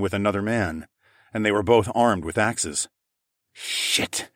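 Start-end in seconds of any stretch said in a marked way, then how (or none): 3.5-4.3 whispered expletive, soft and voiceless; hushed curse. A narrator's reading. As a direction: swear under your breath. Escapee swearing to himself.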